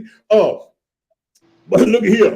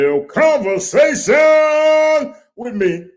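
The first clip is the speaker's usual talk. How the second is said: high, loud, slow announcement; announcer title call; 7 dB louder, much slower than usual. Acted. Host calling out the show title in a slow, loud announcer voice. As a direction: boom the show name out.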